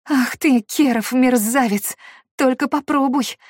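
loud, rough tearful snapping; angry snap. A narrator's reading. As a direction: snap at him through angry tears. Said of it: "по-настоящему грубо огрызнулась Дея".

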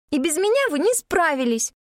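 put-on a child insisting, piqued; offended girl. A narrator's reading. Alexa continues offended.

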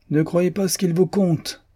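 exclamation aiguë, beaucoup plus haut; s'écrier d'un coup, voix haute; s'écria. A narrator's reading. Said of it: "s'écria le jeune Branton". (le jeune Branton s'écrie, voix plus haute)

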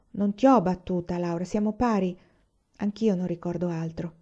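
usual speaking voice

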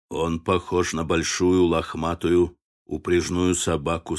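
lightly voiced man, pitched low; low character voice. A narrator's reading. Bill's dialogue voiced by narrator.

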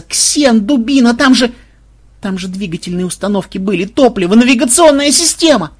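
high loud frantic telling-off; panicked scolding. Acted. Woman scolds the inventor in panic. (возмущённая отповедь)

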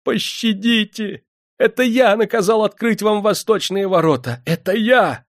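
very high, loud frantic plea; desperate high-pitched supplication; begging. A narrator's reading. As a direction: plead for your life at the top of your range. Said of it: "взмолился".

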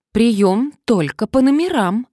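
громче и увереннее, медленно; твёрдо, с напором. A narrator's reading. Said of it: "более уверенно сказала она". (уверенно заявляет)

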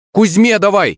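high-pitched urgent call. A narrator's reading. Player to teammate mid-match, high urgent call. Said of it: "крикнул я ему".

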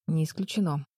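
ordinary delivery

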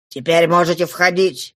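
usual speaking voice

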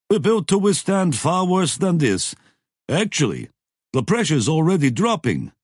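character voice. A narrator's reading. Lobsang answers the captain.

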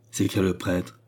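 ordinary delivery

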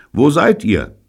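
high, loud demand; calling over radio. A narrator's reading. Neil radioing the lost children, calling loudly. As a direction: call out for their position.

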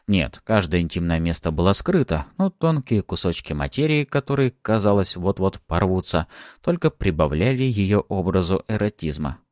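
ordinary delivery, nothing marked

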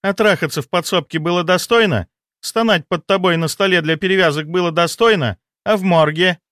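high, accusing woman's voice; indignant mistress. Acted. Spurned mistress reminding him of their trysts, bitterly accusing in a high voice. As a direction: throw it in his face.

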